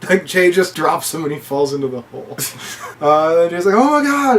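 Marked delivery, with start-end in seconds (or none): 3.8-4.4 shocked. Retold or imitated. Jay drops him.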